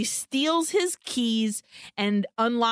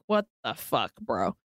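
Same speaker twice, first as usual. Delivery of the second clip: crying voice; 11 semitones lower than usual. Retold or imitated. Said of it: "starts to cry".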